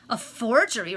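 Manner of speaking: wife aghast at husband's confession, shocked echo; shocked repeat. Acted. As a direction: throw the word back in disbelief. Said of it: "repeated Lady Cecilia, turning deadly pale".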